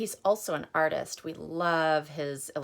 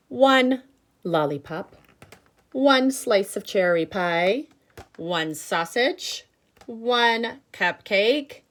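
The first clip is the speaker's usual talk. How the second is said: louder, slowed listing of each food; reading to children; 3 semitones higher, 5 dB louder than usual. Acted. Teacher reading the feast list to her class. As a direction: savour every treat on the list.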